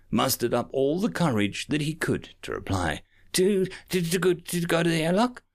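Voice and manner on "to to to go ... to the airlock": lightly squeaky nervous stammer. A narrator's reading. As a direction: stutter it out with a small squeak. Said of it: "he said with a squeak".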